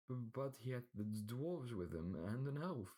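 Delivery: high, slow, frightened stammer; stuttering servant. A narrator's reading. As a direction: stammer out the excuse in fear. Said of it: "a lisping and stuttering man".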